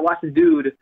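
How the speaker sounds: normal talking voice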